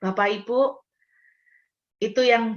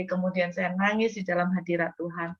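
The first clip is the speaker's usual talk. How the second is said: ordinary talk, nothing marked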